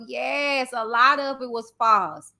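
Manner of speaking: ordinary delivery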